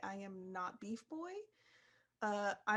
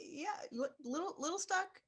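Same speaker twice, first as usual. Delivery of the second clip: strained character voice; 8 semitones higher than usual. Acted. Player answers in character.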